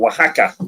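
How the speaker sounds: Oaxaca said as wa-HA-ka; corrected pronunciation. Shown as a demonstration. Guest gently corrects the host on the state's name, Oaxaca pronounced wa-HA-ka. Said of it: "that state is actually called".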